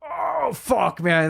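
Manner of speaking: pure fan joy as the band hits the stage; excited outburst. Retold or imitated.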